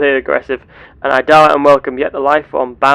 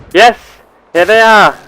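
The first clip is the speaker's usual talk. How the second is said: loud, higher-pitched cry of triumph; excited discovery; 7 semitones higher, 8 dB louder than usual. Pretend. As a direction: exclaim it on spotting the long-sought creatures. Finds the creatures he seeks.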